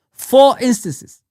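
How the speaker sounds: Four dragged out slow, high and loud; furious stress. Real, not acted. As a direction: spit out four instances in disgust. Furious repeat of four instances.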